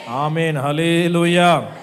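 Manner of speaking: loud, slow drawn-out hallelujah; hallelujah call. Real, not acted. Pastor calling hallelujah to the church, loud and slow. Congregation exclamation.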